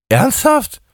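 very high disbelieving squeak; can't believe the brand; incredulous. Acted. Justus incredulous. (ungläubig, sehr hoch)

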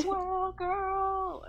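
high, slow echoing call; echoing announcer. Pretend. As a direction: draw it out like a fading echo. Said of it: "it's, like, echoing in the background".

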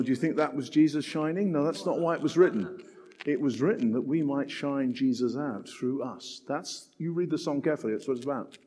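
ordinary delivery

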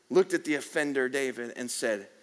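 ordinary talk

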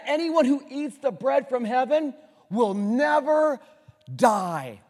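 loud, slow, high preacher cry; full-force preaching. Real, not acted. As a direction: preach it out slowly with fire.

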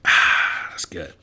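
satisfied breathy ahh after water; refreshed exhale after a sip; after sipping a drink. Real, not acted. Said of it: "Excuse me as I take a drink of water".

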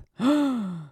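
high sharp intake of breath in mock surprise; surprised gasp. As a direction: gasp like the dare just got real. Eyes get rounder, eyebrows shoot up.

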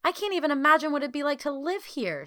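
high, loud outburst of dismay; loud, horrified. A narrator's reading. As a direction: exclaim in horror, pitch way up. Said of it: "she said loudly".

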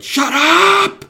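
very high, full-force yell; shouting. Retold or imitated. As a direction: explode at a screaming child, high and loud. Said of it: "I just shout".

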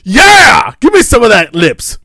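high, loud rowdy cheer; rowdy crowd. Retold or imitated. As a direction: egg them on loudly. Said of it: "everyone's like".

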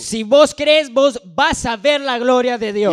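loud, slow proclamation; shouted climax. Real, not acted. Interpreter shouting the promise back to the church. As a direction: belt it back with full force.

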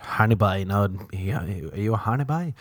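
ordinary talk, nothing marked

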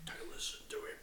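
unvoiced whisper aside. Real, not acted. Friend whispering to the listeners, quiet whisper. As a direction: breathe it as a secret.

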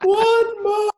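put-on high, loud child's scream; screaming kid. Gamer imitating a kid heard down the line, screamed high. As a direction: shriek it like a ten-year-old online. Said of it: "ten-year-old kid scream down the line".

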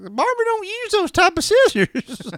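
put-on squeaky confused kid voice, laughing; high mock kid voice. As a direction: play a baffled kid, high and giggly. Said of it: "I was like".

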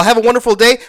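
sneering mock-polite repeat. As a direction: throw their sign-off back at them with scorn. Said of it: "All right, first of all".

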